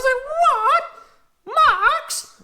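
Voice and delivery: very high, drawn out shock; stunned to see wheel Max; high slow gasp of disbelief. Real, not acted.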